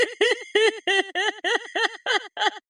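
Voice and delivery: a long high-pitched cackle; high cackling laugh. Acted. Gloating over opponents after insults, high cackle. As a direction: cackle gleefully at your own insult.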